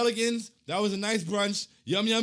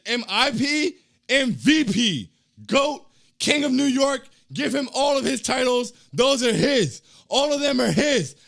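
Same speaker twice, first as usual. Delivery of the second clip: loud, higher hype list; hype shout; 6 semitones higher, 10 dB louder than usual. Retold or imitated. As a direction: crown the player, loud and proud. Reading hype chat comment aloud.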